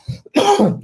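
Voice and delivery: a sudden real cough; coughing fit. Real, not acted. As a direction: cough out sharply. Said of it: "I start coughing".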